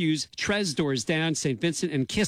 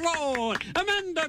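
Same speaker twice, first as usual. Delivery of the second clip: showy introduction. Acted. Host introducing student guest host, showy high flourish. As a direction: roll the R and soar up on the name. Playful repeats of guest's surname.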